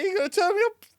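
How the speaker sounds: high, loud mock quote through laughter; hammed-up high voice. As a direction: squeal it out gleefully. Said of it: "He said".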